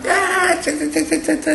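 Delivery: very high-pitched rapid monkey jabber; monkey chatter. Acted. Monkeys answer the angry cap seller, shrill chattering. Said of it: "and they go".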